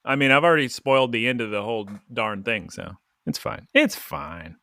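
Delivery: usual speaking voice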